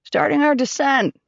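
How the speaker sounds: shouting. A narrator's reading. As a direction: call it back down the cabin. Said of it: "turning to shout at us".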